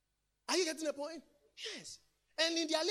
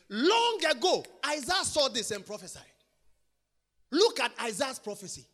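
loud, sung-out preacher cadence rising to shouts; full-force preaching; 4 semitones higher, 14 dB louder than usual. Acted. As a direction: belt the prophecy over the organ. Preaching the prophecy to music.

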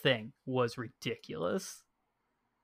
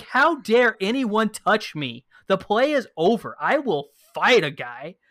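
slow, loud imitation of fury; mock rage; 5 semitones higher, 7 dB louder than usual. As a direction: ham up an outraged player. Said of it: "Dude was infuriated".

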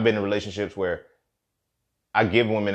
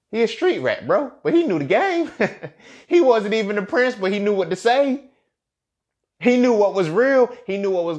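much higher, louder, laughing through it; excited laughing rant; 9 semitones higher, 5 dB louder than usual. Real, not acted. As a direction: get hyped and laugh about Aladdin. Laughing excited Aladdin riff.

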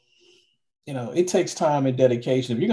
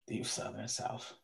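mumbled joke; 4 semitones lower, 19 dB quieter than usual. Real, not acted. Jokey tag on his own claim.

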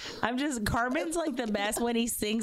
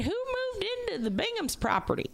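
incredulous high; 11 semitones higher than usual. Retold or imitated. Retold disbelief, pitch jumps.

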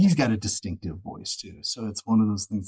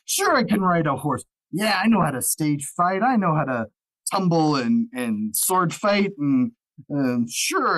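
higher, louder bluffing actor; mock confidence; 4 semitones higher, 5 dB louder than usual. Actor padding a resume for Hollywood work, mock confidence. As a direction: oversell each claimed skill, then hesitate.

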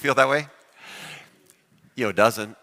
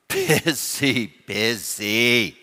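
tired double busy, lightly voiced; weary busy person; much slower than usual. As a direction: sigh it out like someone overworked. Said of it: "make it two busies".

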